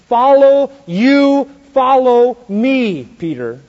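slow deliberate command. Acted. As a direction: draw out Follow and you follow me slowly. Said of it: "So the Lord said it slow".

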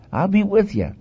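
high-pitched, acted promise; God reassuring Moses. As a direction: lift the voice high, then let it fall. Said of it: "He said".

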